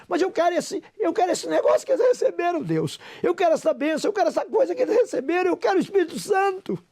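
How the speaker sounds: put-on a little boy urgently pleading with God; a child on his knees eagerly begging God for the blessing; child pleading prayer.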